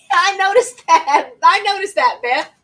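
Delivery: gleeful teasing callout; laughing tease. Real, not acted. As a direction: caught you, said with a laugh. Teasing a chat comment, laughing.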